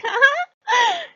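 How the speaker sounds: a sharp high mocking laugh; a cheeky fox god's jeering laugh. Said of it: "he's like".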